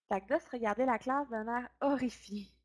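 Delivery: ordinary delivery, nothing marked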